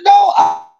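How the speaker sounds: high, loud laughing cry of no; mock shout back at mom; high shouted reply. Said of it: "I said".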